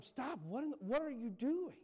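high, loud alarmed yell. Retold or imitated. Carpenter yelling at the farmer chopping his gate, high and loud. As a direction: shout him down as he swings the axe. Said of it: "Yelled at him".

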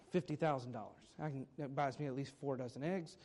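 a playful hushed aside about the money; mock dramatic aside. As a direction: drop the voice low for a joke. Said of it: "Whatever, I shouldn't have done that".

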